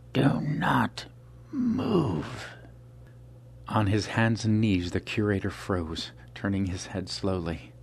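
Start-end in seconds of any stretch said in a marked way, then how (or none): 0.1-2.5 a cold killer's whispered threat rising high; menacing assassin. Acted. Albino attacker voice.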